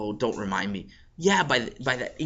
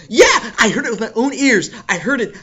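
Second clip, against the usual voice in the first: loud high slow; loud gossip; 11 semitones higher, 9 dB louder, slower than usual. Acted. Beryl to the kids, loud. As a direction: tell it loud.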